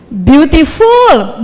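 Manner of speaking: "Beautiful" sangat lambat, tiap suku kata jelas; pengucapan yang diajarkan. Shown as a demonstration. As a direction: eja "Beautiful" pelan-pelan seperti guru. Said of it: "bacanya gimana?". (guru mencontohkan "Beautiful" dengan lambat)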